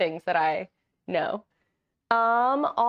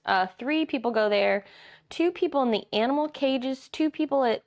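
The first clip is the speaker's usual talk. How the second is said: ordinary delivery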